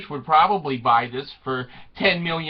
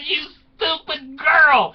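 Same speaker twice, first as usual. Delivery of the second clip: high, drawn-out comic scolding; mock scolding; 8 semitones higher, 3 dB louder than usual. Father berating daughter over her fiance, mock scolding. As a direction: scold slowly in a raised pitch.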